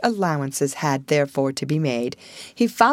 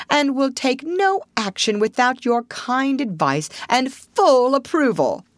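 higher-pitched, earnest contrite pledge; frank self-surrender; 7 semitones higher than usual. A narrator's reading. Toad apologising to Rat, in frank self-surrender. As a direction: own up humbly and frankly. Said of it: "with that frank self-surrender".

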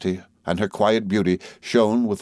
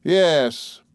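higher, firm one-word reply; immovable; 8 semitones higher than usual. A narrator's reading. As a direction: stand your ground. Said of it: "replied the immovable Mister Micawber".

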